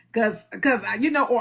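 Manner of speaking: ordinary delivery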